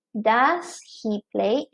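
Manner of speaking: slow, careful classroom example; teacher modelling a question slowly; slow model sentence. Shown as a demonstration. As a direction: lay each word out slowly for learners. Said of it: "teacher models example question".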